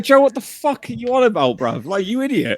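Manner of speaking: high, disbelieving telling-off; exasperated scolding. Retold or imitated. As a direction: scold him with mock outrage. Calling Joe an idiot.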